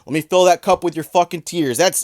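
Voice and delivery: ordinary talk, nothing marked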